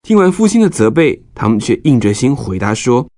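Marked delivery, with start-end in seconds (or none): none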